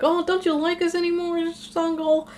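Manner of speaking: high-pitched mock little-kid pleading; put-on cutesy kid voice, high and pleading. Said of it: "cutesy kid thing".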